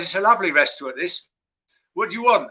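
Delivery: ordinary talk, nothing marked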